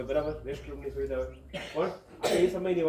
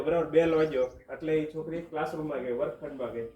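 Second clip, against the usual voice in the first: ordinary talk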